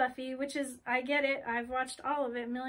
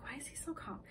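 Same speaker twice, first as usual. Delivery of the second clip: hushed inner thought; 10 dB quieter, faster than usual. Acted. Bree's inner question voiced.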